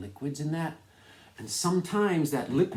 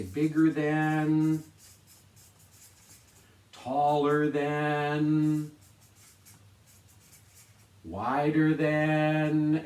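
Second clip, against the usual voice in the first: slow, louder, deliberate listing; slow emphatic examples; 3 semitones higher, 5 dB louder, much slower than usual. Shown as a demonstration. Teacher modelling comparison phrases to class, slow and emphatic. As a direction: lay each phrase out slowly for the kids.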